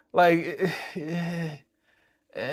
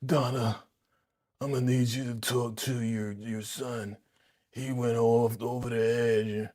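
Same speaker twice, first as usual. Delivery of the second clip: ashy-voiced Antonio; 7 dB quieter than usual. Imitating Antonio calling Donna, croaky and soft. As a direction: rasp it out like a dry throat. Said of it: "Why you talk with an ashy?".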